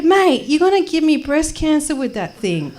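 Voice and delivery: high-pitched indignant complaint; acted protest. Traveller objecting to guard's wand, high indignant protest.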